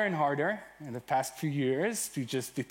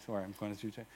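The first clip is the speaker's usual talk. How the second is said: usual speaking voice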